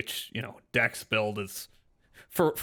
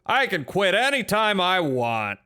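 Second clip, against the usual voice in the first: higher, slower, louder denial; defensive insistence; 7 semitones higher, 4 dB louder than usual. Acted. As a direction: protest a little too much. Repeating claim, more defensive.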